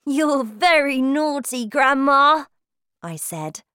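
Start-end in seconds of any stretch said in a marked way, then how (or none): 0.1-2.5 put-on high, loud, playful child's voice; smiling boy. A narrator's reading. Boy playfully scolds his grandmother, smiling high voice. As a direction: tease with a smile as a boy.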